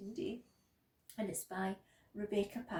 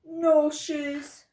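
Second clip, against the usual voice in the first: loud, higher-pitched shout; 5 semitones higher, 16 dB louder than usual. A narrator's reading. Child who kicked off the shoes, shouting. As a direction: yell it as a defiant child. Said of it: "I shouted".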